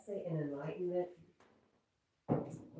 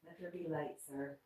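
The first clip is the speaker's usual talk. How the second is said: normal talking voice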